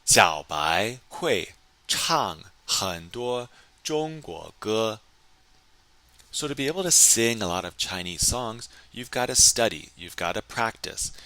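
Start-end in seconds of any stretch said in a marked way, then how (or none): 0.0-5.0 slow, careful model reading; slow model sentence. Shown as a demonstration. Teacher reading the answer to students, slow and careful. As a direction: spell each word out for learners. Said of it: "会 emphasizes a learned ability".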